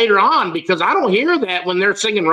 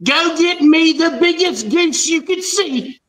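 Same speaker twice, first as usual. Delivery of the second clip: mock English accent; 8 semitones higher than usual. Christmas Carol gentleman sending a boy for a goose, mock English. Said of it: "Christmas Carol".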